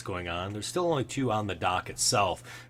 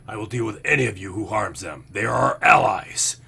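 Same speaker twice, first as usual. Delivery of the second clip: commanding leader; 2 semitones higher, 9 dB louder than usual. Acted. Bugbear leader declaring the humans guests, loud stern command. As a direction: lay down the law to a crowd.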